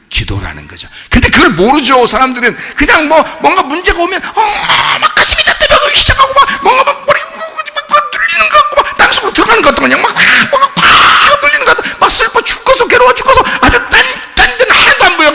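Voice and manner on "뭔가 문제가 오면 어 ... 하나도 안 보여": very high, fast, half-laughing frantic rant; mock panic. Preacher imitating worried people, hammed-up frantic panic. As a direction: ham up someone overwhelmed by worry.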